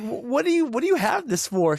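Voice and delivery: high, stammered incredulous question; acted bafflement. Questioning mother-in-law about hoarded antibiotics, acted bafflement.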